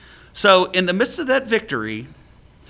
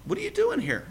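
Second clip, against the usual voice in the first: much higher, incredulous repeat; God repeating the question, much higher and exasperated; 3 dB quieter than usual. Retold or imitated. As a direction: ask it again in disbelief. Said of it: "He says it again".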